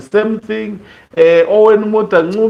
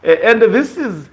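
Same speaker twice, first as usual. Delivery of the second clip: usual speaking voice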